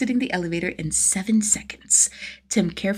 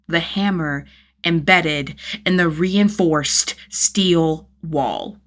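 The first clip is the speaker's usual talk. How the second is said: ordinary delivery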